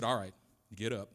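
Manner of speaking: low, soft, understated fatherly order; lightly voiced dad laying down the law; stern dad. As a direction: imitate a father's low, settled firmness. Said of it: "he said".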